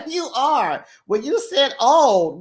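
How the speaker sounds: ordinary talk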